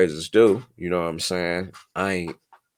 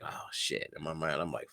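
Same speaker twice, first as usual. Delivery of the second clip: whispered inner thought; 13 dB quieter than usual. Retold or imitated.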